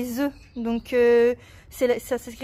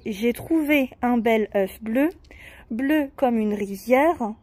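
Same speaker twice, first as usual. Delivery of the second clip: put-on slow, even recital of a children's poem; poem reading; slower than usual. Shown as a demonstration. Mother rereading the poem to her daughter, slow recital. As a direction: read it line by line, slowly. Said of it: "On le relit ensemble?".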